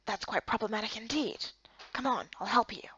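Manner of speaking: lightly voiced sly young man; a hushed, laid-back tease; quiet male character.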